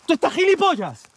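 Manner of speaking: high-pitched furious rant; shouting in disbelief at a gullible partner; exasperated yelling. Acted. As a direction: tear into your partner for believing it. Heated quarrel over the tiger.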